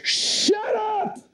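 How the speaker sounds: sudden high-pitched yell; shout. Retold or imitated. Speaker silences their own fear at the plane door, shouted. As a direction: burst out, yelling to silence the voice. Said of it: "I shouted out loud".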